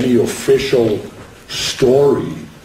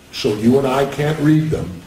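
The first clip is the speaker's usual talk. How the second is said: ordinary talk, nothing marked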